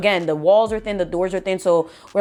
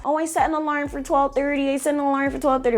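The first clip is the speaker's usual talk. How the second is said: mock excuse; 6 semitones higher, faster than usual. Mimicking friends in bed excusing themselves, mock excuse. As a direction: rattle off a mimicked excuse, pitched up.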